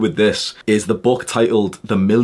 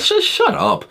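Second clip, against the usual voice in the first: high snap; slower than usual. Real, not acted. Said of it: "snapping at critics".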